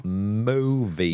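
drawn-out mock British accent; playful British movie-spoof accent; Austin Powers. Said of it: "that's the Austin Powers accent".

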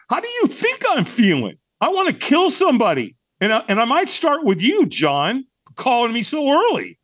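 high-pitched mock fury; irritated outburst. Newcomer snapping at his early-morning caller, comically furious.

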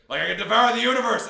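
huge, high-pitched cartoon bellow; over-the-top cosmic menace, very loud; mock villainous roar. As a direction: explode into a hammed-up monster shout. Acts out cartoon Phoenix suddenly roaring.

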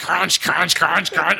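repeated noisy "crunch" as a chopping sound; mimicked knife through chips; imitated crunching. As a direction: chop out each "crunch" like a knife. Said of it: "Right down the middle, like".

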